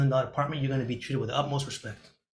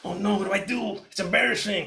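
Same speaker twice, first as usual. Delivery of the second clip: freaking out; 6 semitones higher than usual. Retold or imitated. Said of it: "You start freaking out".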